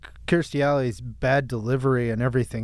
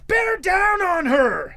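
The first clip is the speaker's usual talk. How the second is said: high, drawn-out shout; hammed-up imitation of a yelled command; mock yell; 13 semitones higher, much slower than usual. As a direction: bellow it slowly like an urgent movie order, pitched way up. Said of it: "she's yelling at the".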